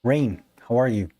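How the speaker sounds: ordinary talk, nothing marked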